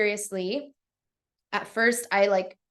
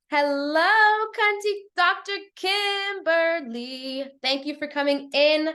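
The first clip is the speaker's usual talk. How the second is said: gushing greeting; 6 semitones higher than usual. Real, not acted. Host welcomes a friend joining the live, gushing greeting.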